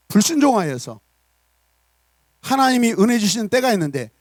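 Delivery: loud, high, stern voice of God scolding; stern rebuke. Acted. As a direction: thunder it down at the people. God rebuking Israel, sermon.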